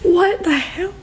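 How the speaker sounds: real shock breaking through; startled. Real, not acted. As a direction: caught off guard by something unseen. Startled mid-vision.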